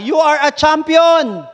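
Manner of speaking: high, loud, slow proclamation; father shouting. Acted. Father shouting praise for the echo to return. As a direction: proclaim it to the whole valley. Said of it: "sumigaw yung tatay".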